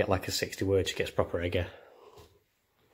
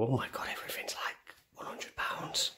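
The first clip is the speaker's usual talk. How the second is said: hushed, mostly unvoiced mock shock; whispered aside; 3 semitones higher, 11 dB quieter than usual. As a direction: whisper it, imitating shock at the prices. Acting out past shock at prices.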